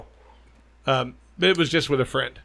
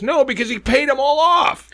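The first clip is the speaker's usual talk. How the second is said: indignant; 11 semitones higher, 6 dB louder than usual. Real, not acted. Angry rant about the players union.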